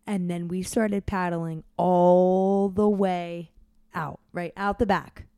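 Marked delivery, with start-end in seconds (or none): none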